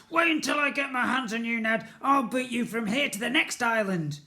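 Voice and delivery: loud, high, furious threat; angry shouting. Acted.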